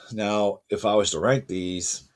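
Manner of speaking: ordinary talk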